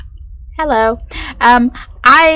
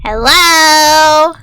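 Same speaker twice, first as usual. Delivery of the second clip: louder, higher hello and woo; excited calling out; 4 semitones higher, 4 dB louder, much slower than usual. Real, not acted. Player calling out into the game, gleefully higher. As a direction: call out into the space with glee.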